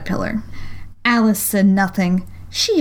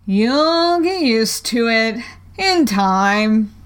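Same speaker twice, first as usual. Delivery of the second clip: very slow caterpillar drawl; languid, soothing creature; 2 semitones higher, much slower than usual. A narrator's reading. Caterpillar voiced in story.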